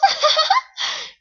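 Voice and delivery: a squeaky, high-pitched giggle; high mocking laugh. Real, not acted. Reader cracking up at the fanfic cliché, high giggle. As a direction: burst out giggling at your own joke.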